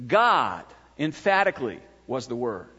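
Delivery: "God" set apart with firm emphasis, falling away after it; stress on God. Shown as a demonstration. Preacher explaining the Greek word order to a congregation, emphatic "God". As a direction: lean hard on "God", then pause and let the rest drop.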